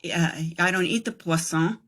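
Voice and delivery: ordinary talk, nothing marked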